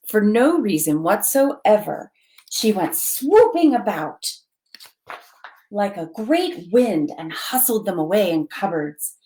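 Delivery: usual speaking voice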